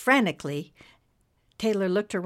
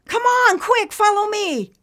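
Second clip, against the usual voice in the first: put-on high, loud urgent girl; urgent call; 9 dB louder than usual. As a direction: hurry them along, urgently. Urging the cloud to hurry.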